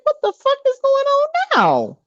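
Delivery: high, baffled "what is going on"; baffled outburst. Retold or imitated. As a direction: squeak it out in disbelief. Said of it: "I'm like".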